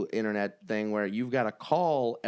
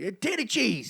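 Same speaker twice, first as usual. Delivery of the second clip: lightly voiced, higher-pitched catchphrase; comedian's catchphrase; 8 semitones higher than usual. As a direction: toss out a hack comic's catchphrase, pitched up. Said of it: "he's got a catchphrase".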